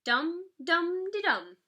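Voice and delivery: rhythmic drum noises; drum beat. A narrator's reading. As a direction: beat it out on the boxes. Drumming on hat boxes.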